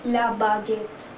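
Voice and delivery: la baguette said lah ba-GET, slowly; la baguette stressed on the last syllable; French la baguette. Shown as a demonstration. Teacher slowly modelling the French word for bread. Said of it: "Then French bread".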